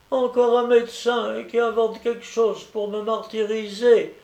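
put-on high, complaining little-boy voice; stammering child. A narrator's reading. Sick boy complains about the doctor, upset. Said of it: "balbutia l'enfant".

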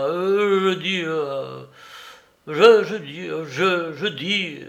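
halting, faltering stammer. Acted. Old Soupe stammers, cowed by Larrier. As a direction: stumble over the words, losing nerve. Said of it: "L'autre annonna".

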